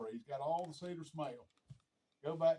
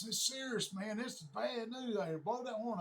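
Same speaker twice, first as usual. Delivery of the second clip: retold urgent plea; 7 semitones higher than usual. Lost friend's urgent plea, retold.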